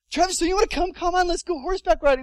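high, loud coaxing call; excited kid urging someone along; imitated eager friends. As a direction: call a friend over to join in. Friends calling him to join.

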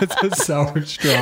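slow, laughing while gagging. Real, not acted. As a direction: choke the words out through laughter. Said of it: "He's gagging".

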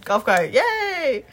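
slow, laughing cheer; mock glee. Dreaming of a golf cart, laughing cheer. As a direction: stretch out the yay through a laugh. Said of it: "I was like".

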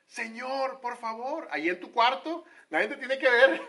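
high, loud plea to God; crying out. Shown as a demonstration. Believer alone in the bedroom crying out to God. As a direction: beg the Lord out loud. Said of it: "un clamor".

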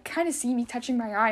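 ordinary delivery

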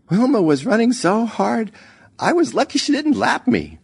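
high-pitched, animated storytelling mother; mother telling her story. A narrator's reading. Mother retelling her Olympic heat, animated high voice. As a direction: pitch it well up, laughing at yourself without a laugh.

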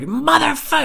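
very high acted curse; retold rage. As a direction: cry it out as you lunge at a rival. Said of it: "so much rage and anger".

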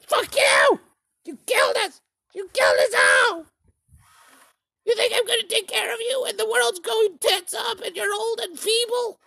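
put-on shrieking, very high furious kid; angry screaming child. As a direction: scream it like an enraged child on stage. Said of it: "We need the children to be like".

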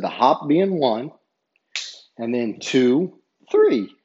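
spelling out the steps firmly, building to three; counting out the steps. Retold or imitated. Referee counting the steps for the coach, emphatic.

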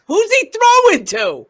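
sudden loud high yell. Retold or imitated. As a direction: shout it out in disbelief. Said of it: "I never heard him yell like that".

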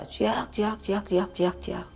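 soft, high, repeated chirping; small distant-sounding chick peeps; imitated chick cry. The creature circling our tent, imitated chick cry. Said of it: "kayak suara anak ayam".

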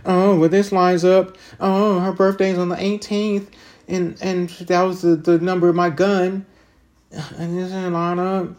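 high, slow mocking voice of his own past thinking; mock inner voice. To listeners, mocking his old self in a high slow voice. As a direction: pitch it way up and drag it out like a naive thought. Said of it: "and thinking".

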